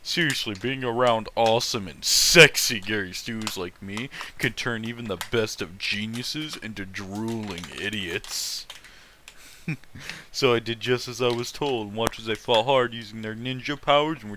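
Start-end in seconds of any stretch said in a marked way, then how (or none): none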